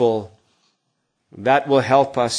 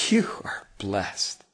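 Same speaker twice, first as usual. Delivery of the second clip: whisper; 6 semitones lower, 10 dB quieter, slower than usual. Real, not acted. Pastor comforts hearers, blessing.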